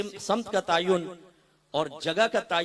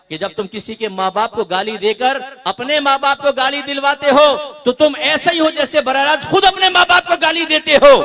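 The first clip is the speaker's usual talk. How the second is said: climbing, loud sermon rebuke; fiery preaching; 6 semitones higher, 12 dB louder than usual. Real, not acted. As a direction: drive the lesson home, rising to a shout. Preaches at full force. (जोश से भरी बुलंद तक़रीर)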